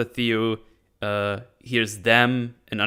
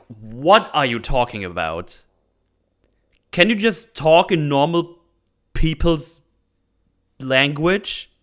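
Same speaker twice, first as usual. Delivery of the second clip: exasperated; 4 semitones higher, 4 dB louder than usual. Retold or imitated. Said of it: "I was like".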